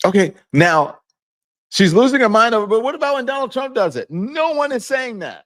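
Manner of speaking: high, loud exasperated rant; she's losing her mind, what about Trump, indignant; heated indignation. Real, not acted. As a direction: vent the outrage. Heated rant against double standard.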